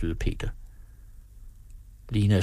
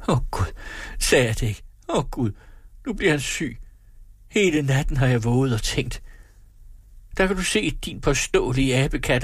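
higher, panicked scolding; frantic mother; 6 semitones higher than usual. A narrator's reading. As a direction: fear spilling into rage at him. Mother frantic over sick son. (panisk mor)

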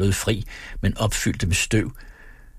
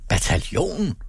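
higher, astonished echo; surprised cry; 7 semitones higher than usual. A narrator's reading. Husband echoing his wife's answer, astonished. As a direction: shout it back in disbelief. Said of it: "råbte Becker forbavset".

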